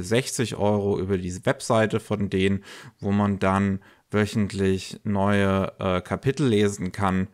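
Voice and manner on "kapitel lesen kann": ordinary talk, nothing marked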